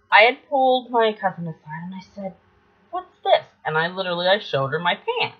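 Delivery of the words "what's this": alarmed. Retold or imitated. Alarmed child self quoted.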